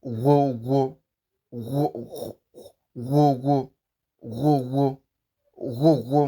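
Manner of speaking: "wo" drilled with a guttural gh rasp before the w; throaty gh sound. Shown as a demonstration. Teacher repeating the example for learners, "wo" with a throaty gh. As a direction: repeat "wo" with a rasped throat onset. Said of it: "sound as I'm pronouncing it".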